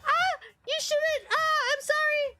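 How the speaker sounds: very high, squealing coy apology; mock damsel. Impostor whose shoulder showed to prince, mock flustered. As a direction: ham up fake embarrassment, far above your voice. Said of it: "shoulder showed and she's like".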